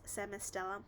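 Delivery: plain reading voice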